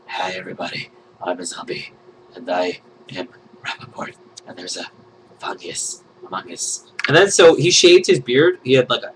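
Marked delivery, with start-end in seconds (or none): 0.1-6.8 low, soft zombie voice. Pretend. Playing the zombie drawn on G plus in a low, soft voice. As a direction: groan it like a lazy zombie.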